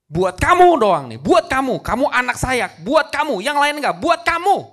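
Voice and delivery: loud, high, insistent appeal; impassioned acted plea; fervent fatherly pleading. King pleads fervently with his son.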